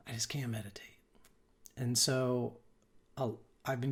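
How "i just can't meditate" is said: softly imitated, near-whispered confession; quiet admission. Someone confiding in a meditation teacher, quiet admission.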